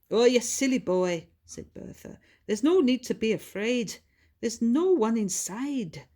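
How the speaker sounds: lightly voiced old lady comforting her dog; soothing the dog. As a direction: reassure a frightened pet.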